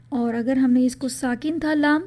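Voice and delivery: ordinary delivery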